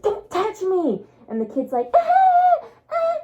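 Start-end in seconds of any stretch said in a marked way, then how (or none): 0.0-1.0 high, slow cry of protest; retold yell. Friend attacked, yelling from ground. 1.9-3.2 very high, loud taunting squeal; mocking squeal. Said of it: "and he's laughing".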